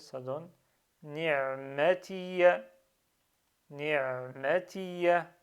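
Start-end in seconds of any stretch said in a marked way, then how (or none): 1.0-2.6 ni'matiya slowly, ending in a sounded "ya"; pronounced final ya. Shown as a demonstration. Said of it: "je dois prononcer le ya". 3.7-5.3 ni'matiya slowly, ending in a sounded "ya"; pronounced final ya. Shown as a demonstration. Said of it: "je dois prononcer le ya".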